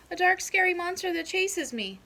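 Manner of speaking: put-on high, loud childish answer; little groundhog voice. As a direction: blurt it out like a small scared kid. Young groundhog answers in class.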